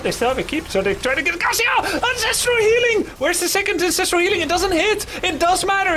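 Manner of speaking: high excited play-by-play; esports caster. Acted. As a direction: call the fight at full hype. Live play-by-play hype.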